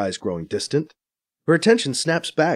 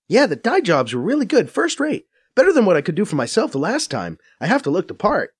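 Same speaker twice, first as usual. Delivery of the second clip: higher, brisk Faiza voice, a bit scornful; Faiza explaining; 7 semitones higher than usual. A narrator's reading. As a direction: narrator playing Faiza, quick and knowing. Faiza talking.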